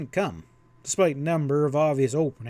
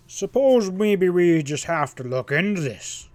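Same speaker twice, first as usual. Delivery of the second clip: put-on louder, grumbling old rancher; 4 semitones higher, 5 dB louder than usual. A narrator's reading. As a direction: grumble it with reluctant uncertainty. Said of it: "he grumbled".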